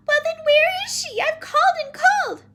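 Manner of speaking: very high, loud plaint; frantic little girl. Acted. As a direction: plead loudly like a frantic child. Frantic child demands answer.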